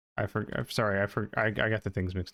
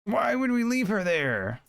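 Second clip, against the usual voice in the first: from a mutter up to a high, loud, slow cry; building exasperation; 10 semitones higher, 3 dB louder, slower than usual. Real, not acted. Player incredulous at the story choice, building to a high slow yell. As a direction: let the disbelief climb until you're yelling it out.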